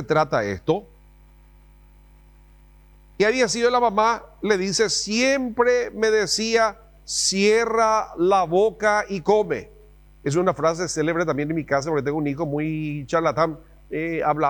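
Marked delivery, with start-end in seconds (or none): 4.9-9.7 higher, very slow mock command; lightly voiced stern mom; ordering mom. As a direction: order him like an impatient mother. Mom's order, acted.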